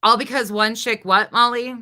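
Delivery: loud, demanding repeat of her words; calling Molly out loudly; mocking challenge. Real, not acted. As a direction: throw her own words back at her, loud.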